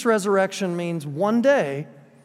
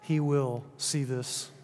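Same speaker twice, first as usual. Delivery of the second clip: tender comfort; 5 semitones lower, 8 dB quieter than usual. Real, not acted. Pastor comforts congregation.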